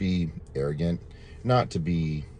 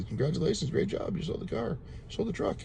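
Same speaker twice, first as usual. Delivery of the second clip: imitating the manager; 12 semitones higher, 5 dB quieter than usual.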